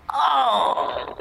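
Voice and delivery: high crying yelp of joy; retold scream. Telling the host how he screamed at the news, high teary yelp. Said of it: "Grité".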